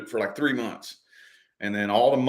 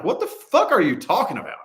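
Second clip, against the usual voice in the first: higher, disoriented outburst; like waking up with no idea where you are; baffled disbelief; 8 semitones higher, 4 dB louder than usual. Retold or imitated. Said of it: "I'm like".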